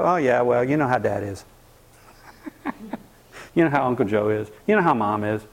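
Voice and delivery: a lightly voiced weary family member; dismissive. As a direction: write the angry relative off, mildly. Family dismissing the angry person.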